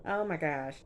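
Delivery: ordinary delivery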